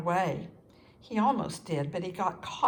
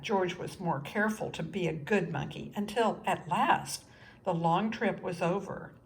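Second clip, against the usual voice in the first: usual speaking voice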